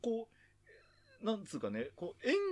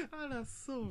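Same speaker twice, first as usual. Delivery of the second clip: put-on a comedian's take on a grand old actress, pitched higher; Mori Mitsuko impression; 10 semitones higher, 6 dB quieter, much slower than usual. Host mocks Nagumo's line as Matsumura's Mori Mitsuko, pitched up. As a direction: ham up "あら、そう？" like a mimic doing a stage veteran. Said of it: "松村邦洋のやる森光子みたいな".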